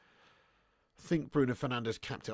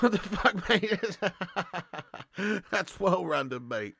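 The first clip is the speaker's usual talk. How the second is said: laughing disbelief; 4 semitones higher, slower than usual. Real, not acted. Shocked laugh at fan video.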